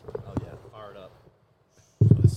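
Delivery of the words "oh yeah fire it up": distant, far from the microphone; speaking away from the mic. Real, not acted. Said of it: "Get as close to that thing as you can for me".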